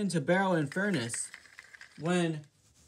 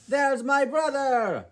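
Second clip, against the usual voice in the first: loud, higher, slow call; excited spotting; 8 semitones higher, 7 dB louder, slower than usual. Acted. Gordon spotting Flying Scotsman in the distance, excited and loud. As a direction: point him out with delight. Gordon spots his brother.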